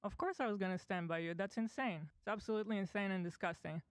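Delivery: ordinary talk, nothing marked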